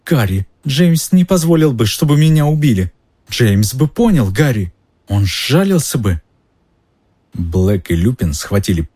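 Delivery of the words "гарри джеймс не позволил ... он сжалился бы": high, desperate appeal; frantic pleading. Acted. Pettigrew begs Harry to spare him, frantic pleading. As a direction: plead for your life, voice pitched up.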